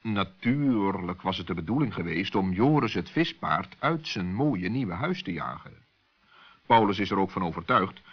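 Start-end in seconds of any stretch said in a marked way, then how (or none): none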